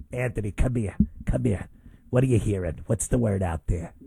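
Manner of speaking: low, soft aunt voice; one of his aunts. Acted. Aunt calling her nephew over for gossip, low voice. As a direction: imitate an aunt confiding, low and quiet. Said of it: "It's like one of my aunts".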